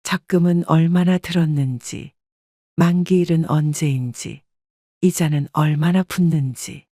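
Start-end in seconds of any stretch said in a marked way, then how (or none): none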